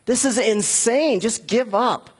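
the enemy king sneering at the people; dismissive taunt. Retold or imitated. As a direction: tell them to give up like it's obvious. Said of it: "he's just like".

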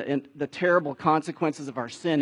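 normal talking voice

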